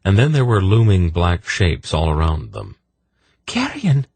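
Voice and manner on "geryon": high-pitched cry of alarm; exclaiming. A narrator's reading. Senedra exclaiming to Geryon as dark shapes loom, high-pitched. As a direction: call out the name in a high voice. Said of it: "Senedra exclaimed".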